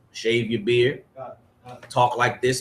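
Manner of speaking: ordinary talk, nothing marked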